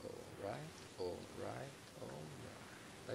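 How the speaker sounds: quiet muttering to self. Real, not acted. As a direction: mumble it under your breath. Muttering before the match.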